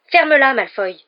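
loud, high furious retort; angry. A narrator's reading. Harry telling Malfoy to shut up, angry. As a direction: shout down your tormentor. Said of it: "répliqua Harry avec colère".

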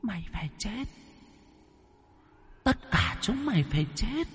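put-on very high, soft, echoing child ghost; a ghost child's echoing curse. A narrator's reading. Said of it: "giọng vang vọng".

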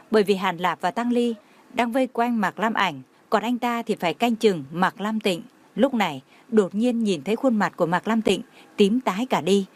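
usual speaking voice

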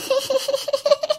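very high, soft staccato giggle; imitated teasing cackle. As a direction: cackle like a ghost teasing its victim. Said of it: "Nó ghẹo ông Năm vậy đó".

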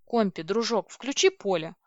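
ordinary delivery, nothing marked

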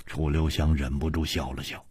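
ordinary delivery

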